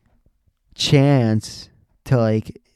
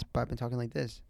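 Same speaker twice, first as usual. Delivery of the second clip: much softer, subdued delivery; quiet mumble; 14 dB quieter than usual. Shown as a demonstration. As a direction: drop into the quiet way you had been talking.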